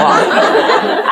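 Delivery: a high, airless laugh; breathy laugh. Real, not acted. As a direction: giggle it out under your breath. Laughs again at the joke.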